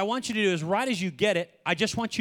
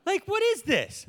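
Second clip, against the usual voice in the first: much higher, startled and bewildered; indignant surprise; 11 semitones higher than usual. Retold or imitated. Pastor confronting a talking bush in his yard, startled. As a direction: confront a trespasser in disbelief. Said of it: "I'd be like".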